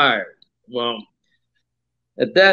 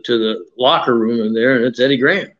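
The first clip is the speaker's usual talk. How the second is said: usual speaking voice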